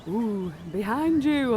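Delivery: the crowd calls out to the performer, slow pantomime warning. Acted. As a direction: warn the hero like a panto audience. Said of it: "the crowd's going".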